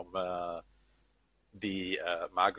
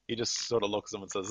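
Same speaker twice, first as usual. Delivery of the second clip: ordinary talk, nothing marked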